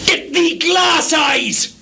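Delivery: loud slow sneering command; scornful shout. Acted. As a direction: spit the insult slowly. Lear mocking blind Gloucester.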